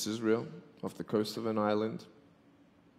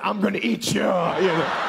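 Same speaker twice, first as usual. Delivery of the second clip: a higher, louder mock monster voice, laughing at the end; playful monster threat; 7 semitones higher, 5 dB louder than usual. Speaker chases the girl, hamming up a monster threat. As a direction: chase her, growling the threat for fun. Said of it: "I'm running after saying".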